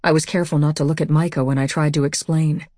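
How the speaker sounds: plain reading voice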